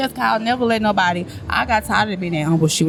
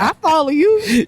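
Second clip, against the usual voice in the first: slow, higher, mocking retort; mocking quote; 6 semitones higher than usual. To the performer who unfollowed him, a slow mocking retort. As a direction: drag it out, taunting him.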